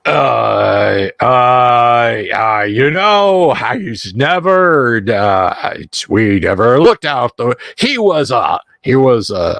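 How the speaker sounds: put-on halting, drawn-out drunk ramble; fumbling drunk trying to explain himself; drunk vagrant. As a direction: stumble and restart, stretching the words.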